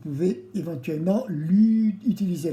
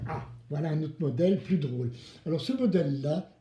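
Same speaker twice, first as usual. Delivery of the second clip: ordinary delivery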